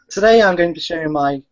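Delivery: camp mimicked vlogger intro; James. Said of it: "Right, I'll do James now".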